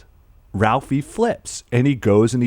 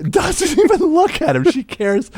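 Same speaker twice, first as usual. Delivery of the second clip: much higher, breaking into laughter; laughing. Real, not acted. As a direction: laugh it out in disbelief. Laughing retelling of mom.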